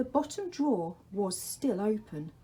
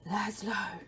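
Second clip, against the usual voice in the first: the Dark's whisper; 2 semitones higher than usual. Acted. The Dark calls to Laszlo in a whisper. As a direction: breathe his name from the shadows.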